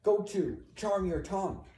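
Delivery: high, sharp hushing command; silencing order. Acted. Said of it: "she's telling him to be quiet".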